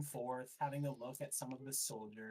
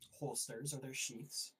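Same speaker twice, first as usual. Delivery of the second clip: ordinary talk, nothing marked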